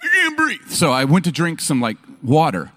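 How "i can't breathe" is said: high strained gasp of words; hammed-up choking panic; mock choking. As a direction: squeeze it out with a closed throat. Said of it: "I'm like".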